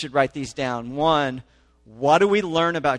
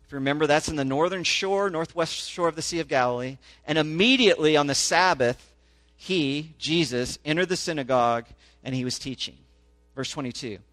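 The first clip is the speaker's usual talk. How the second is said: rising, emphatic public reading of the passage; scripture reading; 3 semitones higher, 5 dB louder than usual. Acted. Pastor reading Mark to his congregation, animated scripture reading. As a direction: read the gospel out to the congregation with growing force.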